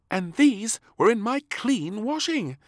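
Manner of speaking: high, loud indignant maid; a shocked maid complaining; exclaiming maid. Acted. Maid finding beans in washing, exclaiming. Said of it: "exclaimed the maid".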